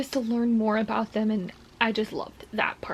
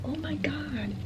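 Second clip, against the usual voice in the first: excited whisper; 5 semitones higher, 7 dB quieter than usual. Real, not acted. Whispering reactions while reading.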